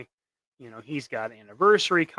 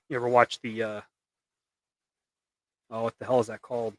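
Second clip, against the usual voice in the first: usual speaking voice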